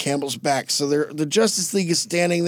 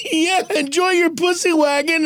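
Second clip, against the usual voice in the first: high-pitched gleeful taunt; mock jeer; 10 semitones higher than usual. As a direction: taunt him through amusement. Superman jeering at Batman.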